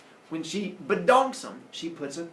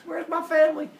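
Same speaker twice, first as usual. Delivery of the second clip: high, raised frantic demand; mock frantic yelling; 13 semitones higher, 6 dB louder than usual. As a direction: yell it high between axe blows. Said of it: "starts yelling at the monsters".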